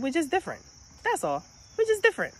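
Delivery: put-on very high, matter-of-fact child voice; squeaky little-kid impression; imitating young son. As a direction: mimic your kid explaining it simply. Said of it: "You know what my son said?".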